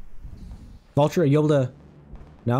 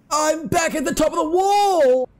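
giddy relief; 12 semitones higher, slower than usual. Real, not acted. Lands safely after the fall.

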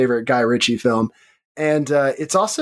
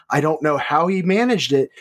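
ordinary delivery

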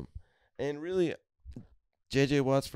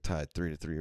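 ordinary talk, nothing marked

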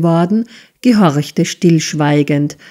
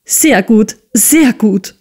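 higher, gleeful approval; gloating; 5 semitones higher than usual. A narrator's reading. Misses Squeers applauds her husband's threat, gloating. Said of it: "frohlockte Misses Squeers".

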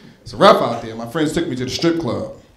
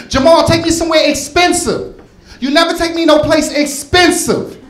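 put-on whiny girlfriend; 12 semitones higher, 3 dB louder than usual. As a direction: mimic a demanding gold digger whining for fancy dinners.

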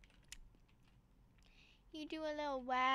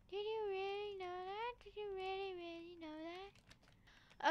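mock crying; 3 semitones higher, 7 dB quieter than usual. Shown as a demonstration. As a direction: whine it as if tearful. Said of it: "shouting, and crying".

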